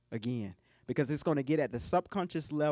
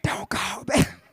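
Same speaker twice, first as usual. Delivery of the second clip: forceful, loud stage whisper; mock whisper; 4 semitones higher, 5 dB louder, much slower than usual. High schooler on a late-night call, forceful whisper. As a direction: imitate a teen hissing into the phone. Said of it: "real good at whispering in high school".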